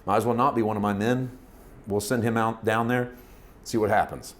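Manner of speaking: resigned aside. Retold or imitated. Saul's imagined reasoning continues.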